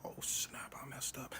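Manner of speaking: whispered "oh snap" to himself; panicked whisper. Retold or imitated. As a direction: whisper it, caught out. John panics under his breath.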